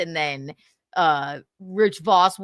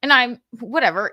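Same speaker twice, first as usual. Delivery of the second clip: ordinary talk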